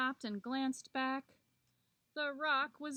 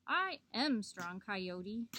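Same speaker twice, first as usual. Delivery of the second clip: usual speaking voice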